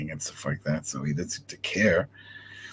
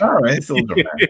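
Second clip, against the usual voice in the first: mock parroting; 8 semitones higher, 8 dB louder than usual. Mocking co-host's words back.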